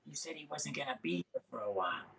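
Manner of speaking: frantic under-the-breath accusation; panicked hush. Retold or imitated. Caught woman panics to narrator.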